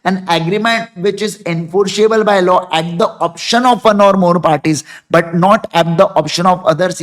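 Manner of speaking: each word read out very slowly and clearly; slow definition reading. Shown as a demonstration. Teacher reads the voidable contract definition to the class very slowly. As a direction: dictate it slowly so students can follow. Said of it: "अब definition को जरा फिर से पढ़ो".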